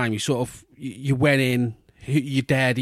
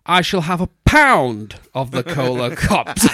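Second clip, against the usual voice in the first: slow, louder, put-on grand voice; posh kid ordering; 2 semitones higher, 6 dB louder than usual. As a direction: order sweets like a little lord. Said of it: "your hands on your hips and go".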